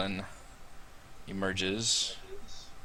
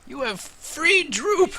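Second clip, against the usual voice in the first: high, slow timid goblin voice; much slower than usual. Acted. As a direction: squeak it like a cowering goblin. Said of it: "The goblin says".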